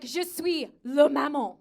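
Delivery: slow, full-voiced statement in French; careful French explanation. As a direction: state it firmly, word by word. First attempt to explain in French.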